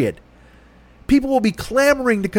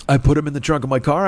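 ordinary delivery

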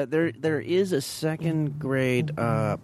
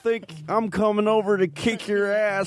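put-on Alabama accent; 10 semitones higher than usual. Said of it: "an Alabama accent".

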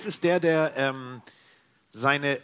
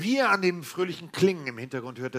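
ordinary delivery

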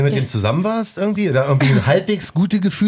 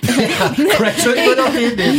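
laughing; 6 semitones higher than usual. Real, not acted. Joking about Crack Johnny.

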